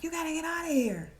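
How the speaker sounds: high-pitched mock urgent order; dramatically hustle her out; imitated yelling. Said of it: "he keeps yelling at her".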